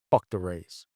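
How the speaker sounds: low, flat-out dismissive "Fuck the Rays"; deadpan. Retold or imitated. Drugged kid answering the friendly helicopter medic, deadpan and low. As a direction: say it dead flat and low, no interest at all.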